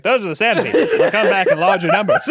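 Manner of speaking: lightly voiced old man warning. Old man in first movie warning Luke, lightly voiced. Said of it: "He's just like".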